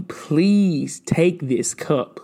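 drawn-out, very slow pleading; slow plea. Acted. Jesus begging God to take the cup, slow pleading. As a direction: stretch each word like a desperate prayer.

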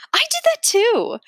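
put-on teacher admitting it to the kids, gleeful; gleeful confession. A narrator's reading. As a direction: confess it with a delighted squeal. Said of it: "Miss Blinny nods".

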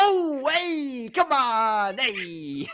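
hammed-up Italian-American wise guy brushing it off; goomba. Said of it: "Aussie and the Goomba".

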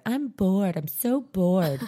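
drawn-out mock whine, slow; a hint of a bored kid's whine; whiny bored kid. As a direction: lightly whine it like a bored child. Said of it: "whining and complaining".

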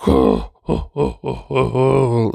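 hammed-up hooting laugh; overdramatic laugh. Friend answers a baffled companion, mock overdramatic laughing. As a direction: imitate his theatrical "oh, oh, oh" laughter. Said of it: "he's laughing over dramatically".

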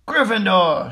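ringing, triumphant-style call; shouted. A narrator's reading. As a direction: shout it to the whole hall. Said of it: "shouted the hat".

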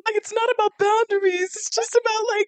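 worried parent. Pretend. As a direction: plead like a hurt dad.

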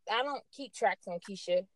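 ordinary talk

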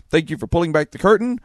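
ordinary talk

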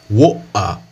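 woa with the T dropped completely. Shown as a demonstration. Teacher imitates a British water without the T. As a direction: say woa, no T sound at all. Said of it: "pessoas falando woa".

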